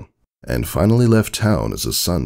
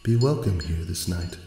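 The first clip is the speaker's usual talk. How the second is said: ethereal voice; 3 semitones higher, 5 dB quieter than usual. Acted. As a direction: speak like a spirit in the water. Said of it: "an ethereal, crystalline voice".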